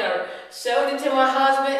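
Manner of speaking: ordinary delivery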